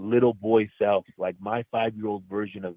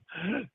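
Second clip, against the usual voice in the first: sharp inward gasp, imitating the baby's breath; imitated gasp for breath; 8 semitones higher, 9 dB quieter than usual. Father shows how dead son gasped, sharp gasp. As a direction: suck in a quick startled gasp. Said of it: "he tried to take a deep breath".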